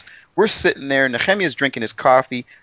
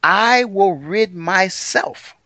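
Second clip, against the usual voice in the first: very slow, higher-pitched emphasis on each word; emphatic divine declaration; 7 semitones higher, much slower than usual. Acted. Host quotes God to make his point, slow and emphatic. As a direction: draw out every word as a solemn pronouncement. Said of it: "Yehovah says this".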